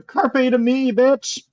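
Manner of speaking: high call, only half shouted; mock scream. Screaming a spell to Anastasia, mock scream. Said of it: "I'm gonna scream to Anastasia".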